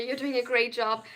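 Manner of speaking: normal talking voice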